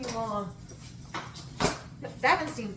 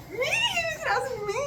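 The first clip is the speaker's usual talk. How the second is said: high, squealing disbelief; high-pitched giddy squeal; shocked squeal. Retold or imitated. Shocked squeal retelling crush's answer.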